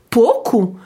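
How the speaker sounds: "pouco" as slow POH-koo. Shown as a demonstration. Teacher gives an example of Portuguese OU, said as O. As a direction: draw out the first O of "pouco". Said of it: "se prononce comme le un O français".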